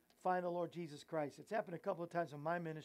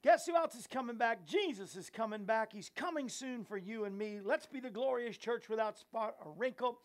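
preaching; 4 semitones higher, 6 dB louder than usual. Real, not acted. Preaching sign-off.

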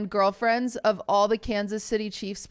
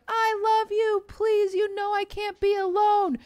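very high, pleading mock scream; imitated desperate screaming; 11 semitones higher than usual. Imitating a woman begging a man who doesn't reply, desperate screaming. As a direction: wail it as a desperate plea, held high. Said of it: "Screaming, top of her lungs".

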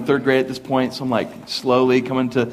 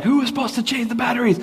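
exasperated dad; 10 semitones higher, faster than usual. Retold or imitated. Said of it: "he was like".

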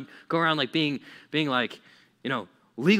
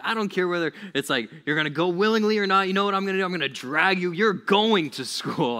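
higher, louder acted insistence; parent laying down law; 5 semitones higher, 5 dB louder than usual. Parent to resisting kid about school, acted firm insistence. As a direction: lay down the law, leaning on the last words. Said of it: "godly parents, they're like".

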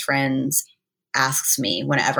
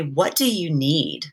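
slow, gently sincere ask; sincere question; slower than usual. Retold or imitated. Friend asking her upset friend, slow and sincere. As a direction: ask it slowly and mean it. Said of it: "the tone that she says it, sincerely asking me".

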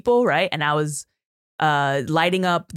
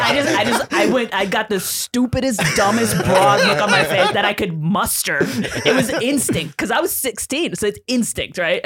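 normal talking voice

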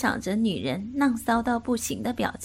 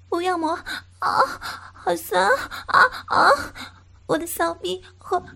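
high woman's voice crying out, louder at the peaks; 9 semitones higher, 4 dB louder than usual. A narrator's reading. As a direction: call out, begging and overwhelmed. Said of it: "他就开始大叫起来".

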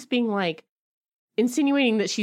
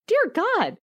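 ordinary delivery

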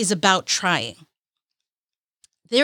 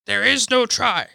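terrible Yoda. Retold or imitated. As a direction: do a deliberately bad Yoda impression. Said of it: "Terrible accent".

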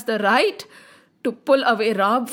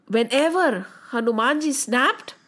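plain reading voice

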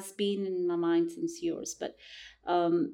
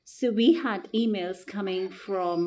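ordinary delivery

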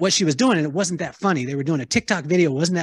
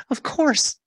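eager yes; 10 semitones higher than usual. Retold or imitated. Eagerly agreeing to Sylvia's request.

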